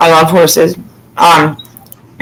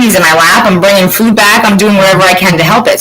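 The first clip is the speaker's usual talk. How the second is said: normal talking voice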